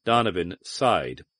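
usual speaking voice

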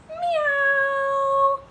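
high, drawn-out meow; long high-pitched cat cry. Acted. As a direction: mew like a kitty. Voices the cat.

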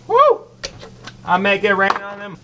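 ordinary talk

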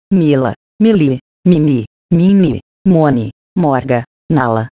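name list reading. Acted. Reads a long list of names. (leitura de lista de nomes, mais grave e pausada)

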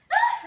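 sudden high shriek; startled scream. Real, not acted. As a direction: scream at the spider web. Sudden spider web.

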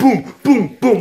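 high, loud booming beat imitation; thumping club beat. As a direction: punch each boom like a club beat. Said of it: "it's gonna be like the thumping".

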